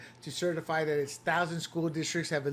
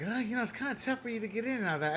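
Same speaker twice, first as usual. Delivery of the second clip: lightly voiced friend, quick and softer; imitating friend; 4 semitones higher, 6 dB quieter, faster than usual. Retelling an Ivy League friend's advice, quick softer mock voice. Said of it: "he was like".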